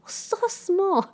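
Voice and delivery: put-on amazed child. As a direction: squeak it out long and tiny. Quoting her small son.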